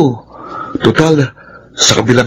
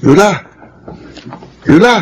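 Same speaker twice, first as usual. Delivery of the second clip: calling out; 7 semitones higher than usual. Acted. Calling Yula from the backyard.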